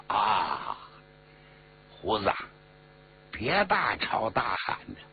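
low, leisurely calm; unhurried. A narrator's reading. Xia Xi tells He Long to stop shouting, unhurried. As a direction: drop the pitch, brush off the shouting calmly. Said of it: "不紧不慢， 是慢条斯理儿".

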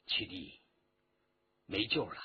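low, soft, grieving words; sorrowful. A narrator's reading. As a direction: break bad news sorrowfully, hushed and low. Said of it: "凄然说道". (凄然低语)

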